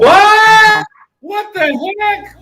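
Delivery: loud, high startled what; surprised shout. Real, not acted. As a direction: blurt it out in shock. Sudden surprise at the chat.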